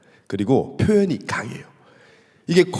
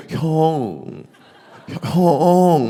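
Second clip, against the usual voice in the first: 어색하게 늘어뜨린 형, 아주 느리게; 낯설고 느끼하게 불러 보는 형; 어색하고 느끼한 형; 3 semitones higher, much slower than usual. Shown as a demonstration. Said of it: "너무 막 느끼하고". (어색하고 느끼한 서울말 형)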